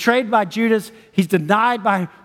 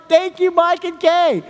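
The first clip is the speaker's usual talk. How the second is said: excited thanks; 9 semitones higher, slower than usual. Real, not acted. Thanking the couple on stage.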